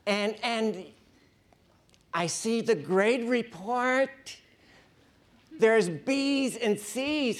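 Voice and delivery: hammed-up guilt-trip, pitch climbing to a drawn-out wail; whiny, never-satisfied parent. Demanding parent God bemoaning the grade report, shrill whiny wail. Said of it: "whiny, never satisfied".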